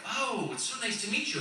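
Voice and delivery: put-on Midwestern accent. Visitor introducing himself to Milwaukee locals, imitated Midwestern accent. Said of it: "speaking in this accent".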